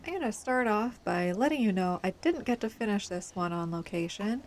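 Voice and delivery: ordinary talk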